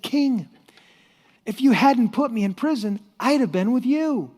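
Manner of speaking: high, pointed punchline; upbeat explanation. Acted. Servant explaining his luck to the king, upbeat. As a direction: spell it out brightly for the king.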